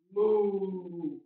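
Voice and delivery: soft mock moo; mild cow moo. Teacher gently moos like a cow for kids. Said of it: "They say".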